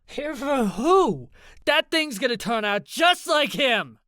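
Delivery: loud, high, outraged shout. A narrator's reading. Ivy rejects Opal's plea about the baby, shouting. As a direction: yell it scornfully, leaning on the question. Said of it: "Ivy shouted".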